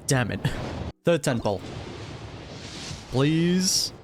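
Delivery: ordinary talk, nothing marked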